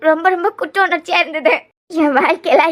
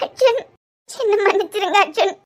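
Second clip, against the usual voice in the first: high tearful apology; weeping, pleading voice; crying; 4 semitones higher than usual. Acted. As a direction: beg forgiveness through sobs. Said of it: "பின்ன அழுதுகிட்டே இரு".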